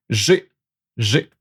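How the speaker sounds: normal talking voice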